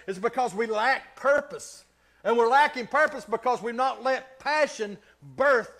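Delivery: loud, high-pitched, driving sermon; full-force preaching. Real, not acted.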